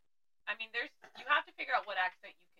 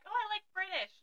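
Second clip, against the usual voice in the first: mock gushing; 9 semitones higher than usual. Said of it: "those typical".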